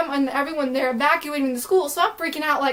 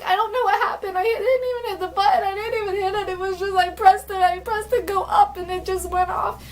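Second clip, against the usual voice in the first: high, whiny, breathless-sounding retelling; panicky, near tears; 5 semitones higher than usual. Retold or imitated. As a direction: act out a tearful, rambling self-defence. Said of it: "kinda crying. I'm all panicky, like, out of breath".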